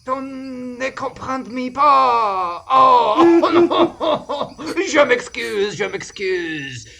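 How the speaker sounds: loud, slow, high mock-sobbing French; wailing French accent. Adventurer mock-wails in French, fearing a misunderstanding. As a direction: wail like a heartbroken Frenchman. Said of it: "I forgot how to make a French accent".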